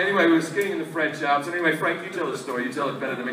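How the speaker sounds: lightly voiced smug diner, low and soft; a hint of pretentious small talk; pretentious dinner guests. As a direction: murmur like a self-satisfied guest. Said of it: "couple of pretentious fucks".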